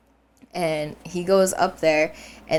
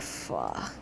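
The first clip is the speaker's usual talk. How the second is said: dread; 15 dB quieter than usual. Retold or imitated. Realizing light switch is far.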